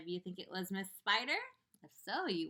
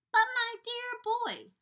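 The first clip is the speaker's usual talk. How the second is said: high; 9 dB louder, slower than usual. Acted. Grasshopper answers, high and slow.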